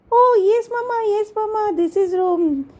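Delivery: put-on high, eager child voice; high-pitched daughter agreeing keenly; little girl Rini.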